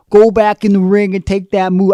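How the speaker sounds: put-on loud, botched New York accent as Russo; failed New York accent. Retelling Russo ordering Goldberg back, loud New York accent. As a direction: bark it like a New Yorker, badly. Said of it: "I can't do a New York accent".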